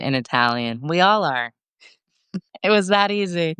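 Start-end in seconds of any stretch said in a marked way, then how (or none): 2.3-3.5 laughing through a joke; chuckling, amused and sarcastic. Real, not acted. Joking that they all speak it.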